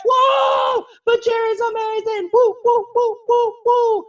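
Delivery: very high, loud whooping; shrieky cheers from the audience; cheering crowd. Acted. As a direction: whoop like a wild fan.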